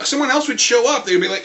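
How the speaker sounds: ordinary talk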